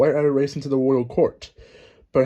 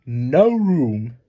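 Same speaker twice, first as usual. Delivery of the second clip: louder shouted no room; loud refusal; 5 dB louder than usual. Acted. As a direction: bark it out to turn her away. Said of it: "the pair shouted".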